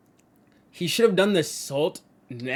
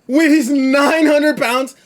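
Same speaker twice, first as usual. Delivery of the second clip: shocked disbelief; 11 semitones higher, 11 dB louder, slower than usual. Real, not acted. Repeats in disbelief, laughing.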